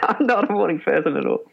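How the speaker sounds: a real laugh under the words. Real, not acted. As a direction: chuckle while owning up to it. Laughing repeat of own admission.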